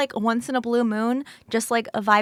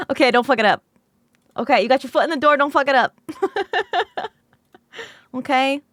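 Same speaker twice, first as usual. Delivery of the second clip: usual speaking voice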